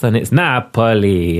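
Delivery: Napoli with Italian vowels, NAH-po-lee; Italian place name. Shown as a demonstration. Reader explaining the translation, Napoli said in Italian. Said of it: "It's written Sicily and Naples".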